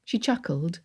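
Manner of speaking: ordinary delivery, nothing marked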